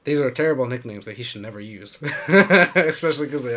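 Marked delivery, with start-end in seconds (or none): 2.0-3.6 talking through real laughter, louder; laughing. Real, not acted. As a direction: laugh your way through the remark. Laughing at their own nicknames.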